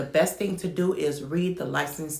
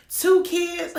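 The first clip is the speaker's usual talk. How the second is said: put-on high, loud, laughing scorn at two kids; laughing mockery; 13 semitones higher, 4 dB louder than usual. Vlogger mimicking the rep laughing at her two kids. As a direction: scoff through the laugh like the rep did. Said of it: "This lady laughed so hard".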